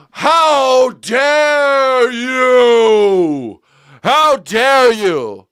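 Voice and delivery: high, loud, drawn-out hammed-up indignation; mock outrage. Streamer shouts at a doubting chat in mock outrage. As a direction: shout it like a scandalized loyalist.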